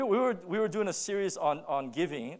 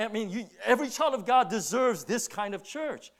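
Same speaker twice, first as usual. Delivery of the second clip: usual speaking voice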